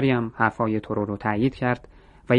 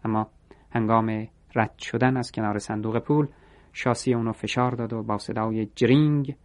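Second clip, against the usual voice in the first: ordinary delivery, nothing marked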